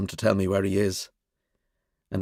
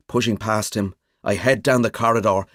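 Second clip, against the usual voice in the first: usual speaking voice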